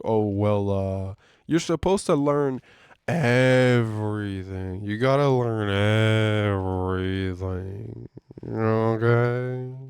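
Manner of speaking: slow, drawn-out mock manager voice; mocking the boss. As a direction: drag it out like a condescending supervisor.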